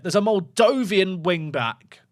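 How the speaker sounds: ordinary delivery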